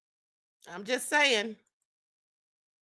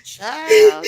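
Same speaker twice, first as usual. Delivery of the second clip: laughing exclamation; 6 semitones higher, 13 dB louder than usual. Real, not acted. Host cracking up at photo.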